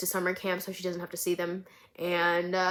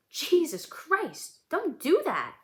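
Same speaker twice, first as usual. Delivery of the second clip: much higher, drawn-out startled cry; startled yelp; 9 semitones higher, slower than usual. Acted. Woman startled by a stranger behind her, shrieking. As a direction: jump out of your skin, then scold.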